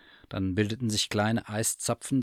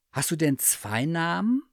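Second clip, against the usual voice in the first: higher curious bear voice; eager teddy bear; 8 semitones higher than usual. Acted. Bear asks the cat about her wish, high and eager. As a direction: ask like a hopeful little bear.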